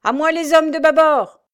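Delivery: officer bellowing an order; commanding shout. A narrator's reading. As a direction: summon the crew with a bellow. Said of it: "il commanda".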